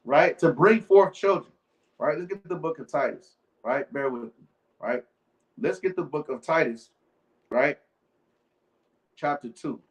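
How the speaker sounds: ordinary delivery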